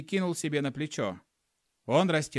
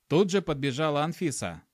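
ordinary delivery, nothing marked

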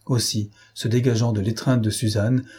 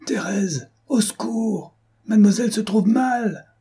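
high-pitched call for help; alarmed cry; 10 semitones higher than usual. A narrator's reading. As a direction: cry out urgently for the maid. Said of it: "cria la gouvernante".

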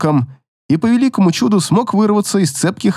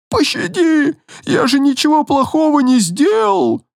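high desperate begging; terrified pleading; 11 semitones higher than usual. Acted. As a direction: beg for mercy in panic. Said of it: "взмолился несчастный".

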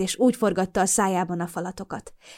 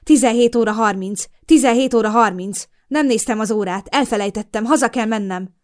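loud, higher, frantic repeating; a man suddenly seized by fear, louder; panicked; 3 semitones higher, 7 dB louder than usual. A narrator's reading. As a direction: blurt it out in rising terror. Norman panics about the time.

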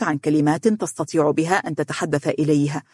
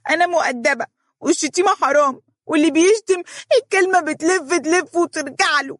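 put-on very high, whining child voice; tearful little girl; 12 semitones higher than usual. A narrator's reading. Little girl defending her manners, high and tearful. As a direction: protest as if about to cry. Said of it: "صاحت مهرة بصوت يشبه البكاء".

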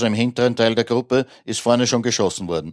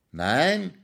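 soft, high rising echo; incredulous question; 7 semitones higher, 4 dB quieter than usual. A narrator's reading. Master hears the servant refuse, incredulous. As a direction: echo it back in soft disbelief.

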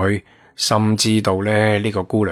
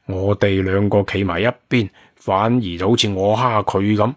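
ordinary delivery

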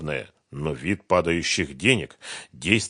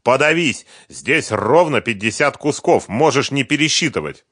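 loud, raised, angry outburst; spiteful; 7 semitones higher, 7 dB louder than usual. A narrator's reading. Фёдор handing fifty grand to Сухарев, spiteful. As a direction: throw it at him with spite. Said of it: "злобно сказал Фёдор".

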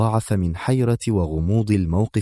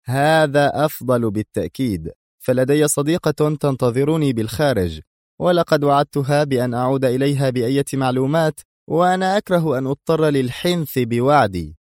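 higher-pitched, composed threat; measured menace in a raised register; cool woman boss; 6 semitones higher than usual. Acted. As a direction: state terms as a crime boss.

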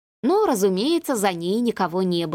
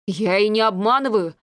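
breathed out. A narrator's reading. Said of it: "выдохнул Василий".

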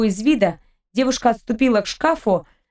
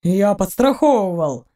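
indignant. A narrator's reading. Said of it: "возмутился Гаен".

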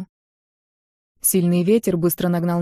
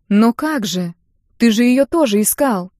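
higher, insistent appeal; dismayed protest; 3 semitones higher, 3 dB louder than usual. A narrator's reading. Daughter objects to father dropping the find, dismayed. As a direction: object in disbelief, pitch lifting.

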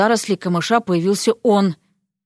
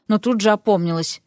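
usual speaking voice

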